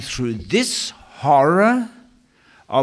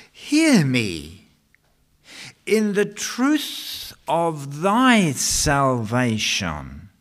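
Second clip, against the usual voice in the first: building scripture reading; 5 semitones higher than usual. Acted. Preacher reading Psalm sixty-nine aloud, building to a plea. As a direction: climb from measured reading to a pleading peak.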